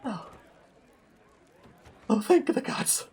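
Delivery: high relieved breath; relieved sigh. Acted. Maxwell's relief voiced.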